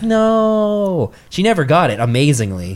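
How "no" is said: very high, drawn-out mock wail; comic high-pitched dread; retold scared wail. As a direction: imitate a frightened girlfriend's long refusal. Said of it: "so scared".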